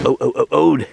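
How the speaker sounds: real pained cold moans; shivering cold groans. Real, not acted. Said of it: "that is cold".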